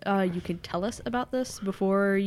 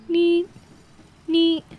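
high ringing tone; 11 semitones higher, 6 dB louder than usual. Pretend. Player's joking ringtone.

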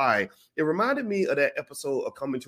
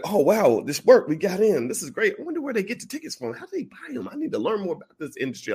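excited inner voice; 10 semitones higher than usual. Retold or imitated. Own excited thoughts retold.

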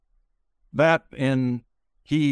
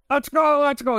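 rah-rah cheer. Retold or imitated. Imitating a rah-rah captain firing up teammates, high excited cheer. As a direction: pump up the team like a cheerleading captain. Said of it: "He didn't do any of that stuff".

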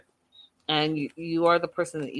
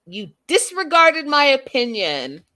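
high, loud, drawn-out accusation; mock indignant; 6 semitones higher, 7 dB louder than usual. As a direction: play an offended boyfriend, stretching the words. Boyfriend's acted quarrel.

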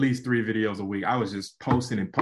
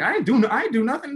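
higher, louder "I ain't do nothing"; defensive denial; 12 semitones higher, 5 dB louder than usual. Retold or imitated. To teasing teammates, a high defensive protest. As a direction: protest your innocence, half laughing.

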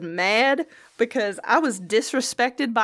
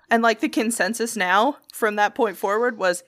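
usual speaking voice

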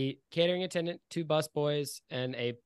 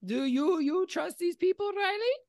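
rising ever higher, squeaky question; Isaac's high voice; 11 semitones higher, 5 dB louder than usual. Acted. Isaac asks Riley whether he trusts them, climbing high.

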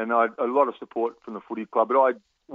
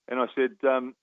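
usual speaking voice

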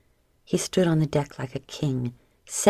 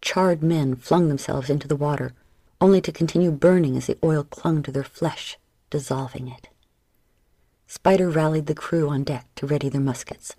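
usual speaking voice

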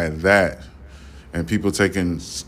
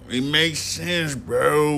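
higher, softer and slow, teasing; playful high voice; 5 semitones higher, 4 dB quieter, much slower than usual. Acted. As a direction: sing the catchphrase back in a goofy high voice. Playful repeat of catchphrase.

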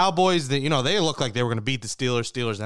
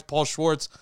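normal talking voice

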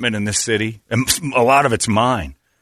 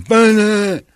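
high, loud calling out; hammed-up frantic calling; mock scream; 13 semitones higher, 5 dB louder, much slower than usual. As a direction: yell for the lost dog. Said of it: "started screaming".

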